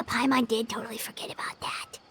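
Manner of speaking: fast, breathy whisper to herself; sheepish whisper, half hiding the admission; whispered aside. Acted. Paimon admits to herself she forgot, whispering quickly.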